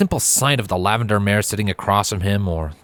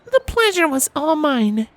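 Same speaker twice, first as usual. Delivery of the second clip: smirking Twilight. A narrator's reading. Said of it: "with a smirk".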